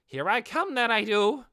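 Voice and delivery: high, loud war cry as she launches; charging battle cry. Acted. Giant hamster charging at Ainz, a loud high battle cry. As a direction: yell it out as she leaps to attack.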